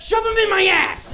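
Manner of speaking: loud hammed-up yell; mock shout. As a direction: bellow it for the crowd. Crowd work gross-out shout.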